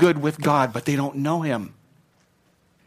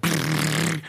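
vocal imitation of a ticking population counter; counter sound effect; 3 dB quieter than usual. Shown as a demonstration. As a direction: make the counter noise with your mouth. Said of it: "You like that sound effect?".

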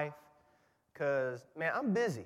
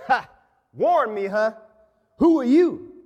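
mocking burglar; 8 semitones higher, 11 dB louder than usual. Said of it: "The burglar said".

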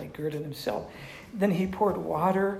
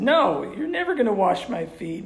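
raised, higher-pitched insistent no; emphatic refusal; 5 semitones higher, 6 dB louder than usual. Acted. Preacher voices Peter refusing the foot washing, loudly emphatic. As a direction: refuse loudly, acting out the disciple. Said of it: "it's emphatic and great".

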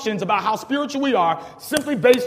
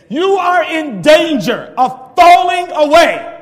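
slow, loud, higher booming warning; voice of God; 7 semitones higher, 11 dB louder, much slower than usual. Acted. Preacher voicing God warning the disciples, slow and loud. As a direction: thunder it out slowly as God. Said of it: "what if God himself said to you".

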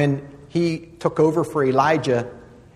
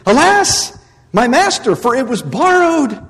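high, loud anguished cry; cried out; 8 semitones higher, 7 dB louder than usual. Acted. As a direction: wail it over the lost borrowed axe. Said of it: "he cried out and he said".

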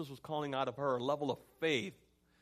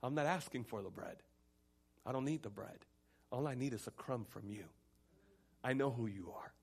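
soft, lowered, assured plea; quiet faith; 3 semitones lower, 6 dB quieter than usual. Acted. Woman trusting Jesus for a crumb, soft assurance. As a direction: speak the faith gently, sentence by sentence. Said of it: "notice her faith".

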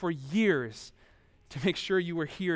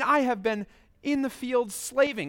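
put-on resentful older son; 6 semitones higher than usual. Preacher voices resentful older brother.

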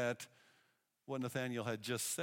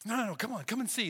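higher, coaxing insistence; pleading with a doubting friend, higher; eager urging; 8 semitones higher, 4 dB louder, faster than usual. Retold or imitated. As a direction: wave him along eagerly. Philip urges Nathanael to come.

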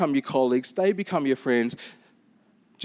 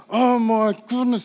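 slow, louder exasperated groan of words; retold exasperation; 4 semitones higher, 5 dB louder than usual. Pastor reliving being stuck behind a slow shopper, exasperated. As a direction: drag it out in mock frustration. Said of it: "I'm getting angry. I am like".

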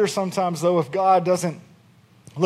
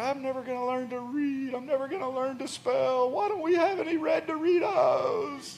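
put-on high, whiny child's voice rising to a shrill cry at the end; wailing little girl; 6 semitones higher, 5 dB quieter than usual. Father imitating his daughter's tearful wailing. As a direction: play the upset six-year-old melting down. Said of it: "She's like".

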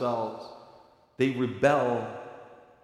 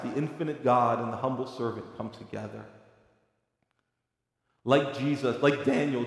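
ordinary delivery

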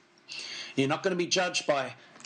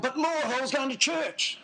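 imagined plea, pitched much higher; crying out; 8 semitones higher than usual. Pretend. Sinner waking in hell appeals to the Lord, crying out. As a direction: protest to the Lord in a raised, pleading pitch. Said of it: "you're crying out to the Lord".

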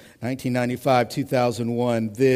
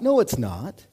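usual speaking voice

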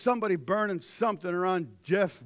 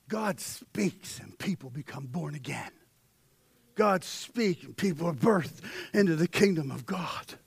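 usual speaking voice

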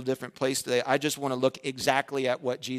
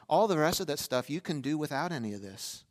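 normal talking voice

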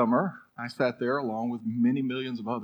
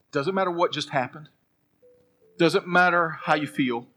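louder and higher, building each line; rising preaching shout; 7 semitones higher, 10 dB louder than usual. Acted. Preacher rallying the congregation, loud and higher. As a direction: drive it home like a pep talk.

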